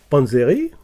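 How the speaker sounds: ordinary delivery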